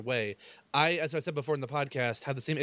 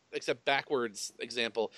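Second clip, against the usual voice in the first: usual speaking voice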